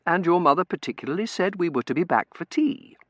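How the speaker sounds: firm, lifted reminder; composed, emphatic protest. A narrator's reading. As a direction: object politely but insistently. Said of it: "Cousin Verity protested".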